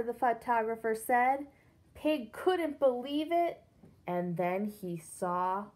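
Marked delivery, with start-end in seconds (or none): none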